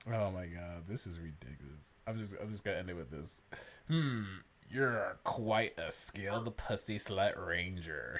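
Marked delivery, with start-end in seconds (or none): 3.9-8.2 purring. Acted. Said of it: "she purred".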